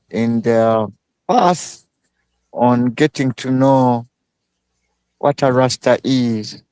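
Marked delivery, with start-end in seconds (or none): none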